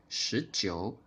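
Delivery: normal talking voice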